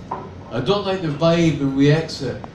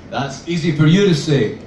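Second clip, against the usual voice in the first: ordinary delivery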